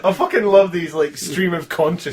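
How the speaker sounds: loud, high, gleeful; delighted. Real, not acted. Loving the fanfic's rambling, loud and gleeful. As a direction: relish every word.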